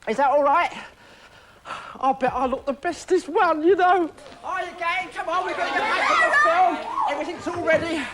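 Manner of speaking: gleeful high-pitched call to action; excited. Acted. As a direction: hurry everyone back to set. Excited rush back to filming.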